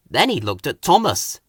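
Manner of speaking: plain reading voice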